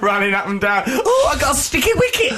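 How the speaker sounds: high, squealed ninny voice; hammed-up high-pitched fluster; little ninny. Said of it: "Like a little ninny".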